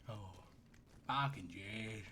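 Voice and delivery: ordinary talk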